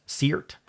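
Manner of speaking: "Seert" starting with an S sound; Seert with soft C. Shown as a demonstration. Trying out a made-up word, "Seert" with an opening S. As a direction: say "Seert" as SEERT, rhyming with Kiert. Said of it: "would you pronounce that".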